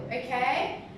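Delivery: ordinary talk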